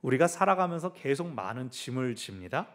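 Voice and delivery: ordinary talk, nothing marked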